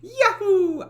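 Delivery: a high, loud, hammed-up Italian cartoon cheer; Italian cartoon yahoo. Shown as a demonstration. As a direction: belt out a goofy Italian "Yahoo!". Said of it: "I have to get the voice back".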